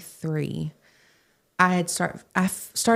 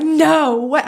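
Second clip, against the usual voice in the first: much higher, loud disbelieving squeal; shocked delight; 10 semitones higher, 8 dB louder than usual. Retold or imitated. Reacting to the pastor's offer, loud thrilled surprise.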